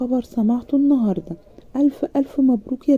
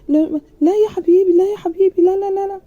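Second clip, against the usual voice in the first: much higher, loud flustered no; panicked refusal; 10 semitones higher, 5 dB louder than usual. A narrator's reading. Bride at the door, panicking as the groom asks to enter. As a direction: fluster and block him from coming in.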